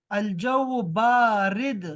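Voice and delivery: "الجو بارد" very slow, long first vowel in "بارد"; slow model pronunciation. Shown as a demonstration. Teacher modelling the word for cold, slow and deliberate. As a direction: stretch "بارد" out for the learners.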